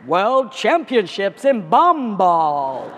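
slow, loud mock American announcer; drawn-out, booming American accent. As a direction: boom it out like an American sports announcer. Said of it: "comes out in an American accent".

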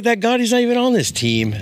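mock surprise. Retelling surprise at the game.

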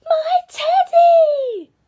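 very high, long drawn-out cry; high, loud stretched wail. A narrator's reading. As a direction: cry out slowly for a beloved toy. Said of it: "cried Eddie".

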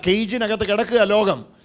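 usual speaking voice

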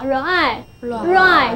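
"R I" as separate slow sounds, then blended into "right"; sounding out. Shown as a demonstration. Teacher building the word from its letters, slow sounding out. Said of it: "所以这个字就是R".

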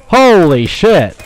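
loud, high, drawn-out exclamation; startled outburst. Real, not acted. Player reacting to a firefight, startled shout. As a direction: stretch it out in loud shock. Startled during game combat.

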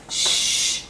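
unvoiced librarian-style shush. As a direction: hush someone with a sharp shh. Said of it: "Why do you keep shushing me?".

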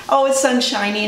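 plain reading voice

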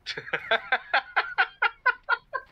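high shrieking giggle; squealing laugh. Real, not acted.

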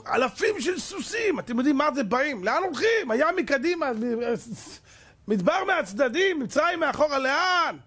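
high-pitched cry of people trapped with nowhere to run; panicked outcry. Acted. Acts out Israel trapped at sea. (זעקת בהלה בקול גבוה)

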